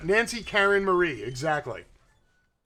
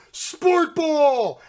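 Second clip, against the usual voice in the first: a loud, high shout of Sport ball; mock yell; 8 semitones higher, 7 dB louder than usual. Telling would-be rioters what to yell, a loud mock shout. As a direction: bellow it like a silly fan. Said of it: "just yell".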